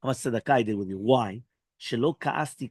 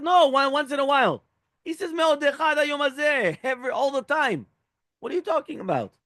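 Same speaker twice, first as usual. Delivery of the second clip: much higher, insistent mock indignation; indignant retort; 12 semitones higher than usual. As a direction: push back hard like an outraged animal. Donkey indignantly contradicts Bilam.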